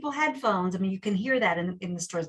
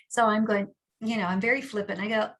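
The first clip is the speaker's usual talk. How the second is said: normal talking voice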